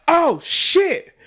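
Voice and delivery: high-pitched startled curse; mock alarm, jumping up high; alarmed realization. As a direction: react as if suddenly caught. Acting own alarm when caught.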